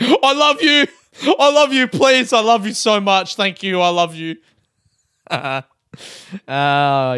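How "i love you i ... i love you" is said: higher, louder gushing love pleas; a dude out of control of his emotions; over-emotional sign-off. Retold or imitated. Vlogger mocking own sign-off, gushing loud. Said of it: "just like".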